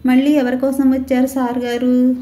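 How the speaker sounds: mocking. Chamundi mocks the returning officer.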